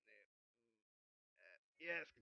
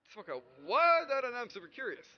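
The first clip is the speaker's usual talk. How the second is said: eager; 3 semitones higher, 11 dB louder than usual. Real, not acted. Eager, curious.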